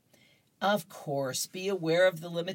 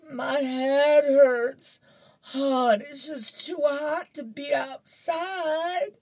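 much higher, louder, slow whining complaints; whiny kid Curtis; 11 semitones higher, 7 dB louder, slower than usual. Acted. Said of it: "and he goes".

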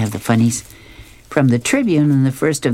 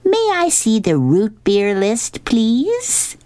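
high, slow request to a waiter; high cartoon dog playing the suave customer; Snoopy; 11 semitones higher, slower than usual. A narrator's reading. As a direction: order in a raised, put-on sophisticate's voice. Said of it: "turns to an imaginary waiter and he says".

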